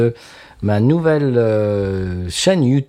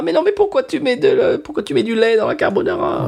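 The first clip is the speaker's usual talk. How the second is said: voix très aiguë, outrée, à l'italienne; chef italien outré; 13 semitones higher than usual. Retold or imitated. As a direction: imiter un chef italien scandalisé. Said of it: "très haut en couleur". (chef italien excédé par une recette ratée, voix perchée)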